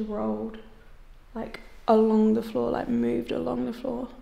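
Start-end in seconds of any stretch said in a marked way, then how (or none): none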